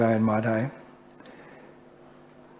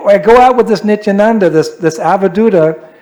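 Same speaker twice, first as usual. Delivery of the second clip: higher, louder fed-up complaining; exasperated complaint; 6 semitones higher, 6 dB louder than usual. Retold or imitated. Haridas complaining to Mahaprabhu about his partner, exasperated and loud. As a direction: vent to the master, exasperated. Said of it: "He'd say".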